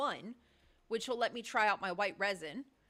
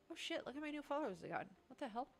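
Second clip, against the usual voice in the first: usual speaking voice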